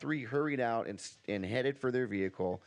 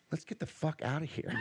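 mock hoarse whisper; 5 semitones higher than usual. A narrator's reading. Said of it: "hoarsely whispered".

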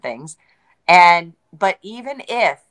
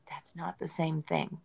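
much softer, slower quoted reaction; quiet aside; 16 dB quieter than usual. Retold or imitated. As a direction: drop way down, as if thinking it. Acted quote of own reaction.

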